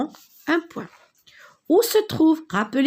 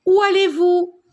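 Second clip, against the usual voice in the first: much higher and louder "Où allez-vous?"; raised question voice; 9 semitones higher, 8 dB louder than usual. Shown as a demonstration. Teacher reading an example question in a raised voice. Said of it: "vous devez toujours élever un peu la voix".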